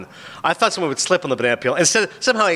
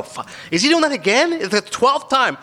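exasperated; 9 semitones higher than usual. Retold or imitated. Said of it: "you go".

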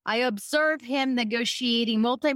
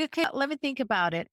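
rushed, dismissive quote; tossed off fast; quick brush-off; much faster than usual. Retold or imitated. Prospect brushing off an over-talking salesperson, rattled off fast. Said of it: "there's like".

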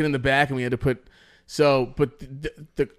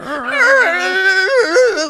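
loud, high, sustained screech; imitated car screech; 10 dB louder than usual. Storyteller imitating a towed car, long piercing screech. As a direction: wail like a car dragged on its brake. Heard the car the whole way going.

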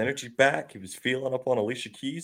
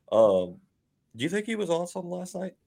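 ordinary talk, nothing marked

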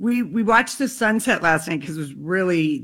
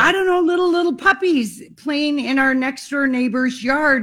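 high excited delight; 6 semitones higher than usual. Retold or imitated. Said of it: "and I thought".

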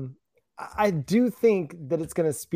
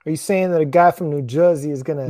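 hammed-up New Jersey accent; terrible New Jersey accent; 5 dB louder than usual. Co-host teasing host about the guy from New Jersey, bad New Jersey accent. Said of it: "that's a terrible New Jersey accent".

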